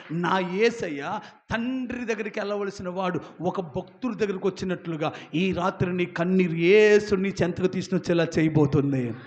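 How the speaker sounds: ordinary delivery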